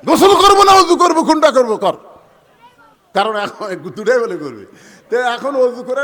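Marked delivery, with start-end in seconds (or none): 0.1-2.0 high, loud exasperated question; shouted retort. Acted. Son asks father whether to bathe or wash, shouted retort. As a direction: throw the question back loudly.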